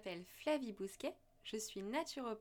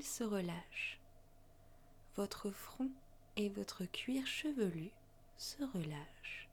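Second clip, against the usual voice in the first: guided meditation. Acted. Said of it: "Je vous invite à suivre ma voix lors d'une méditation".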